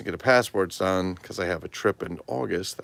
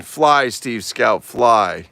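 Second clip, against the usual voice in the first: slow, loud heroic call; dramatic send-off; 4 semitones higher, 13 dB louder, much slower than usual. Pretend. Playing with the Steve Scout figure, heroic call. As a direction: launch the toy like a hero.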